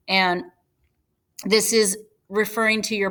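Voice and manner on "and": normal talking voice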